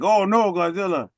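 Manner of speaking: high, loud panicked cry. Retold or imitated. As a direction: cry out like a terrified bystander. Voicing panicked onlookers.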